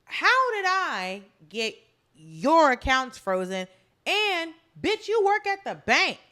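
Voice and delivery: incredulous comeback. Pretend. Host talking back to the accuser, indignant and incredulous.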